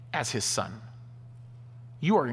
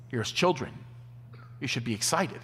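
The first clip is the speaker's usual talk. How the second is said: ordinary talk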